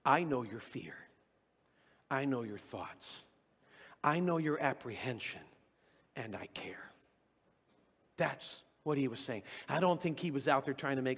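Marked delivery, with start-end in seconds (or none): 0.1-5.4 tender God. Retold or imitated. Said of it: "And God comes back and goes". 6.1-6.9 whispered, barely there; tender whisper. Retold or imitated. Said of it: "And God comes back and goes".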